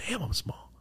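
hushed, whispered letdown; small, breathy mock dismay; deflated reaction. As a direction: whisper it to yourself, let down. Retold private letdown at doctor.

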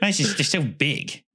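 put-on nerd complaint, drawn out; complaining nerd. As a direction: play a loser nerd griping. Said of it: "loser nerd who was like".

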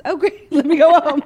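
high laughing words. Real, not acted. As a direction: laugh through it. Laughing bit.

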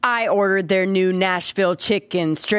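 last word held absurdly long; mock poet stretching STRIPS out; hammed-up spoken-word reading; slam poetry. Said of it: "Slam poetry".

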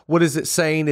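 ordinary talk, nothing marked

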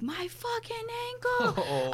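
slow, high, strained cry of pain; pained wail. Retold or imitated. Injured snowboarder crying out about her ankle, slow and high. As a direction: stretch it out high like it hurts.

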